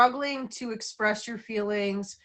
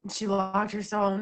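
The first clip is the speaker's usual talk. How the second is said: usual speaking voice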